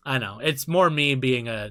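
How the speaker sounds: ordinary talk, nothing marked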